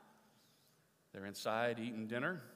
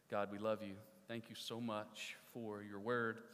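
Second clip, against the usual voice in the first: closing prayer; 4 semitones lower, 6 dB quieter than usual. Real, not acted. Pastor praying softly for his congregation at the close of the sermon. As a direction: pray quietly over the congregation.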